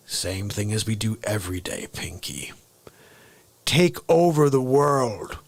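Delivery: deep deadpan reply that builds to a slow, grand finish; the Brain. Acted. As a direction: Orson Welles-style cartoon villain. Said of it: "I'm gonna do an impression".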